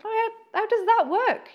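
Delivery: high, bewildered mock question; baffled question. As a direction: ask it as if utterly baffled by the healing.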